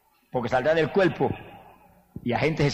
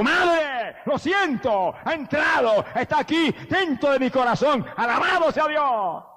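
much higher ecstatic shout, slowing at the end; shouted cry; 10 semitones higher, slower than usual. Acted. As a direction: cry out to mother overjoyed. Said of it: "se sacó un grito". (grito exaltado y muy agudo)

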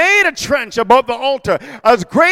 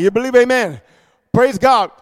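usual speaking voice

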